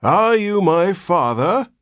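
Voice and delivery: put-on high, slow, loud old man's demand; cracked ancient voice. A narrator's reading. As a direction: demand it like a creaky old man. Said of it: "in a cracked and ancient voice".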